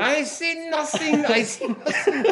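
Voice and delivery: slow, high German sergeant voice; German accent Schultz impression. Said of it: "I love Schultz".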